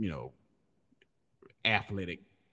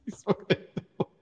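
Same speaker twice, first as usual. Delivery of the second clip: high, wheezy laughing words; laughing hard; 13 semitones higher, 4 dB louder than usual. Real, not acted. Host cracks up at own dope joke, laughing hard. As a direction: squeeze the words out through laughter.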